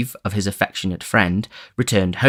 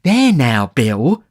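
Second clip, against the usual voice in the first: eager remonstrance; 5 semitones higher, 5 dB louder, much slower than usual. Acted. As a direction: jump on his word.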